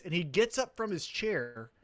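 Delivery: ordinary talk, nothing marked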